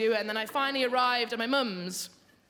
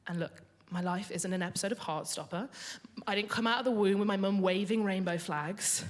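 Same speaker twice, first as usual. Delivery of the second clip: usual speaking voice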